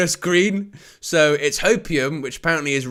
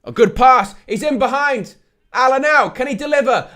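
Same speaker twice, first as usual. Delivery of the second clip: excited play-by-play, louder on the big moments; live match commentary; 7 semitones higher, 6 dB louder than usual. Acted.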